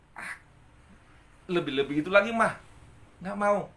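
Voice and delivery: put-on a kid pushing away raw egg; disgusted child. Child voiced in story. (anak kecil jijik menolak, suara lebih tinggi)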